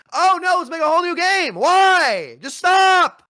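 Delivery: shouting in exasperation; loud, high, fed-up outburst; frustrated shout. Real, not acted. Ranting mock company then outburst.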